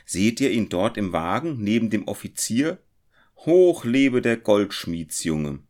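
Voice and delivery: mild festive shout; crowd calling. A narrator's reading. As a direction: cheer the hero from the street. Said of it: "riefen sie".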